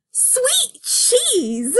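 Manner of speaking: high squeaky cartoon cry; squeaky cartoon exclaim. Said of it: "my voice acting skills".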